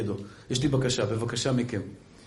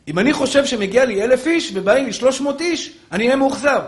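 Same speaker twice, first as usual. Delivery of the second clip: loud, higher-pitched sermon delivery; impassioned preaching; 10 semitones higher, 8 dB louder than usual. Acted. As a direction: preach it forcefully to the congregation. Preacher at full force.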